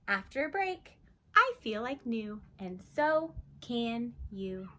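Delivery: slow, sing-song picture book read-aloud; reading to children. Acted. Teacher reading a calm-down book to kids, sing-song. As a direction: read it gently to a little one.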